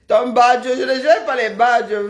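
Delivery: loud protest about the badges; loud call-out. Real, not acted. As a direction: call across the room to her. Calls out to Félicia.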